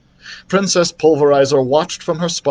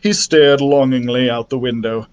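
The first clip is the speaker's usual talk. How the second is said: ordinary delivery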